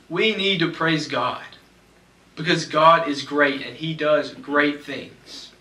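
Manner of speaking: ordinary talk, nothing marked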